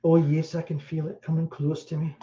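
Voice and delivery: lightly hammed-up Scottish medium sensing a spirit; mock Scottish psychic. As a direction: channel a ghost in a put-on Scottish accent. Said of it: "I'm not even doing it right".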